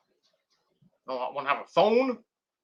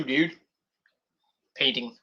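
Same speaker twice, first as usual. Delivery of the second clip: ordinary delivery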